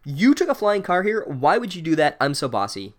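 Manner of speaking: high-pitched mock scolding; bossy girl. Bossy girl questioning boys about the flying car, mock bossy. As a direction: imitate an indignant know-it-all, starting high. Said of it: "Bossy Girl comes up to them and is immediately like".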